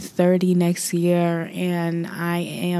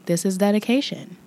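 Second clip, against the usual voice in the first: ordinary delivery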